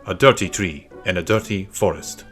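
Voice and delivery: ordinary delivery, nothing marked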